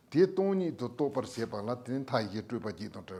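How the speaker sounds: normal talking voice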